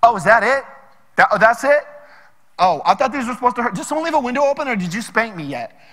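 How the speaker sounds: loud, taunting kid mocking the punishment; mock trash talk. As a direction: sneer it out like a cocky brat. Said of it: "trash talk. He'd be like".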